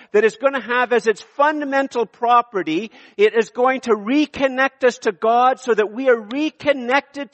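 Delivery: high, intense preacher's push; fervent sermon climax; impassioned preaching. Acted. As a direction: preach it up with rising fervor. Preaching builds to high pitch.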